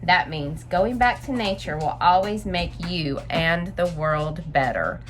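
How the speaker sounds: ordinary delivery, nothing marked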